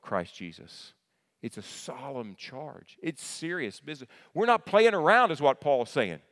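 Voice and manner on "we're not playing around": higher and louder, punched; emphatic outburst. Real, not acted. Preacher warning the church, loud and high. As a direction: make them sit up.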